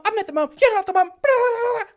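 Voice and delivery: very high, very loud mock screech; shrieking bride. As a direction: scream it in a hysterical catfight. Bride yelling in a quarrel.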